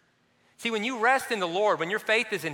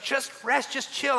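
higher mock wife voice, coaxing; wife pleading; 7 semitones higher, slower than usual. Wife coaxing restless husband to chill, higher mock voice. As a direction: lightly imitate a coaxing wife. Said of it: "She's like".

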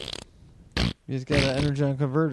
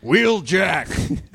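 Johnny Carson impression; 5 semitones higher than usual. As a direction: say it like a late-night host. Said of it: "that sounded like him. Johnny Carson".

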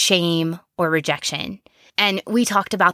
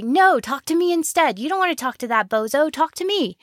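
pushy voice; 8 semitones higher than usual. Retold or imitated. Pushy inner part butting in.

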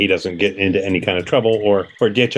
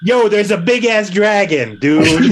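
casual dude voice; 9 semitones higher, slower than usual. Acted. Player does casual in-character line.

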